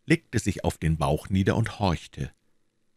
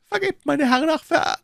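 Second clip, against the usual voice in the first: much higher, louder voice breaking off mid-word; gasping for mercy as she is gagged; choked pleading; 4 dB louder than usual. A narrator's reading. The captive woman begging her captors, choking off. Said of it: "lallte die Stimme".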